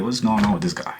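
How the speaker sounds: ordinary talk, nothing marked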